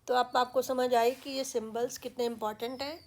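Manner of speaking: normal talking voice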